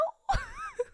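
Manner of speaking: a shrill burst of real laughter; high squealing laugh. Real, not acted. Streamer squealing with laughter at her own story.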